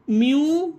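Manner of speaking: म्यू drawn out as "myoo"; syllable shown alone. Shown as a demonstration. Teacher building the word in parts, drawn-out म्यू. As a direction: isolate म्यू as one rising syllable. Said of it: "M यू का म्यू हो गया".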